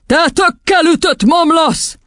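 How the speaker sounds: high, slow, furious yell; howling insult. A narrator's reading. Headmistress at the objecting boy, yelling. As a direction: hurl the insult word by word. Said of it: "üvöltött rá Doron kisasszony".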